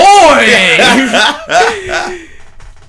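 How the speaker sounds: a high, loud whooping laugh; loud burst of laughter. Real, not acted. Podcaster cracking up at the movie line, howling laugh. Cracks up at his own quote.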